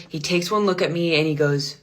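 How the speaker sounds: ordinary talk, nothing marked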